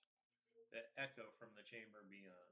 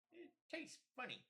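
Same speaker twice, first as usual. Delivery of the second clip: squeaky goblin. Acted. Goblins chatter over food.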